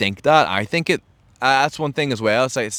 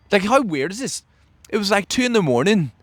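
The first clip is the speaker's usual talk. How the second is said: amazed; 10 semitones higher than usual. Real, not acted. Baffled by a weird message.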